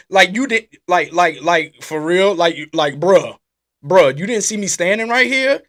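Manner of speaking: indignant "bruh" at someone ignoring you; mock confrontation. Confronting the usher who ignores him.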